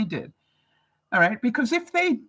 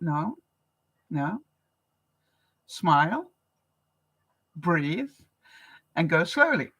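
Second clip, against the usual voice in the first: calming self-talk; 3 semitones lower, slower than usual. Retold or imitated. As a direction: talk yourself down gently. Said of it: "and I thought".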